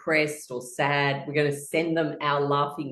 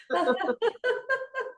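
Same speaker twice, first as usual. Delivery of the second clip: breathy high giggle. Real, not acted. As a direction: let a real giggle out. Laughter yoga session.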